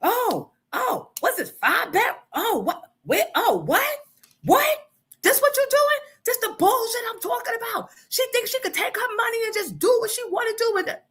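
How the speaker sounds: high, incredulous imitated husband; mock outrage. Husband snatching the lease, mocked high and outraged. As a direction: ham up his shocked anger. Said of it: "He snatches it again".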